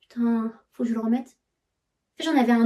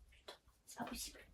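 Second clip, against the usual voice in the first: whispered swearing; 29 dB quieter, faster than usual. Real, not acted. Muttering alone while tidying.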